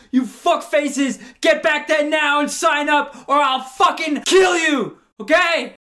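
high, shrieking furious sales pitch; a sweet woman suddenly screaming threats at the room; screaming rage. Pretend. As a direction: scream it at the audience like a raging tyrant. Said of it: "by saying".

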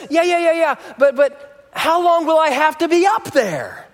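put-on a child cutting mum off; impatient little girl. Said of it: "And Ruby says".

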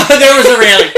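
laughing. Real, not acted. Laughing repeat.

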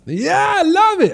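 high, bright and thrilled; delighted excitement. Real, not acted. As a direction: gush with delight. Delighted outburst.

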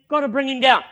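loud retold vow of a young fighter; bold, forceful assurance; confident declaration. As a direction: declare it firmly, punching it out.

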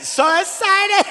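high, slow squeal of "So excited!"; mock gleeful attendant cry, drawn out; imitating the attendant. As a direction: sing it up high like the ride operator. Ride name in the attendant's voice.

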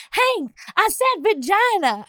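high, slow mock exclamation; imitated Peggy. Peggy telling Hank what she said, high mock exclaiming. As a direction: pitch it way up, proudly announcing. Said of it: "she's like".

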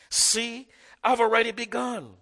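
"See, I've already begun" pitched high, "I've" hit hard; stress on already begun. Shown as a demonstration. Preacher repeating the key verse to church, high and emphatic. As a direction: ring it out as the key line. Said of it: "That's the new emphasis in the text".

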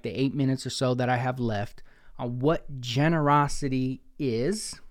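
ordinary talk

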